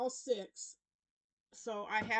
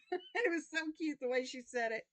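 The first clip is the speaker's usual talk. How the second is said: laughing; 7 semitones higher than usual. Real, not acted. Laughing after the cute quote.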